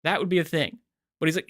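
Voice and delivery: usual speaking voice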